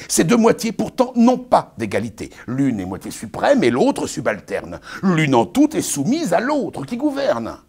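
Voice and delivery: pompous Arnolphe, lecturing his bride; booming, sermonizing stage declamation; hammy stage actor. Acted. Performs Arnolphe's tirade.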